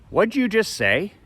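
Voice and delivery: high-pitched, disbelieving question rising at the end; imitating a stunned dad's challenge; incredulous challenge. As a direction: demand it as if you can't believe your ears. Dad confronts son, stopped dead.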